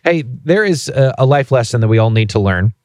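ordinary talk

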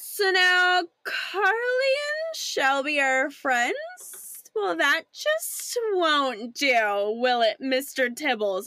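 very slow, drawn-out camp villain; milk it as a campy schemer. Acted. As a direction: start high and stretch every word theatrically.

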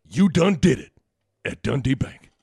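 drawling spokesman catchphrase; Mark Jackson impression. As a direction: deliver it like a TV pitchman. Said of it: "you give the catchphrase".

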